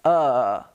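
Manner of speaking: low, relaxed neutral "Uh"; schwa sound. Shown as a demonstration. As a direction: model "Uh" for a learner to copy. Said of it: "Just follow me one more time".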